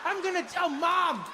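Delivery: put-on high-pitched child's accusation; acted tearful tattletale threat; aggrieved little kid. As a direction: whine it like a betrayed small boy. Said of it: "I know this kid was like".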